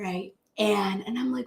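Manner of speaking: ordinary talk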